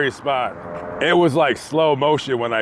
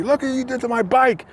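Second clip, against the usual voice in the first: pitched-up mock yell at the driver; retold yelling; 8 semitones higher than usual. Rider scolding the driver, a mild mock yell. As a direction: accuse her, voice high. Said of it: "cussing and yelling".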